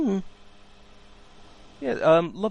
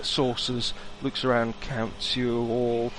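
ordinary delivery

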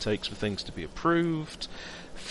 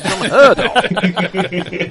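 laughing mock outrage; 7 semitones higher, 12 dB louder, much slower than usual.

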